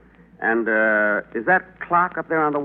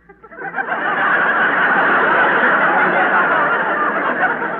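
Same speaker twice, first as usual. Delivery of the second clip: laugh; 5 dB louder than usual. Acted. Laughs at elevator name.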